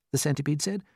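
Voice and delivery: usual speaking voice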